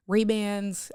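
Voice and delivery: ordinary talk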